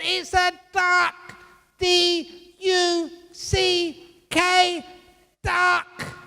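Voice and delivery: very high, loud, drawn-out mock shouting; over-the-top exasperated husband; hammed-up yell. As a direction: bellow it slowly at a high pitch, letter by letter. Husband yelling at quarrel's climax.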